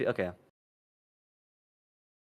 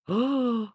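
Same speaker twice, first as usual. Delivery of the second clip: gasp; 14 semitones higher, 5 dB louder than usual. Real, not acted. Sudden surprise at screen.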